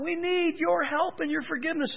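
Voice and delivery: high pleading cry for help. Retold or imitated. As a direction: plead upward, like calling out to God. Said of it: "they cried out".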